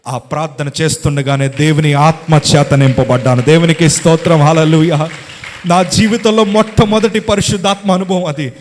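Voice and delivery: loud, driving preacher's proclamation; fervent preaching. Acted. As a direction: lift the congregation with praise. Preaching at full force. (బిగ్గరగా ఉత్సాహంతో ప్రసంగం)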